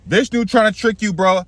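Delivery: high-pitched urgent warning; Shukra. Acted. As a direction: warn the king, pitched well up. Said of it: "This is Shukra".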